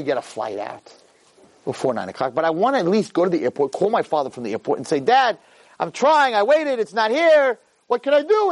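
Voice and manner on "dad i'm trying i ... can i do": pleading excuse. Pretend. Planned excuse to father.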